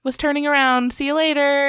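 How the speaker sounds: high, loud flustered self-quote; flustered excuse. Retold or imitated. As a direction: re-enact a hasty, awkward exit. Retold hasty exit after trespassing.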